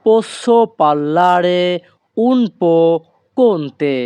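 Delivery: very slow, every syllable laid out clearly; slow teaching demo. Shown as a demonstration. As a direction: spell the phrase out slowly for learners. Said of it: "এই বাক্যের Italian ভাষা হবে". (ধীরে ধীরে স্পষ্ট করে বলা)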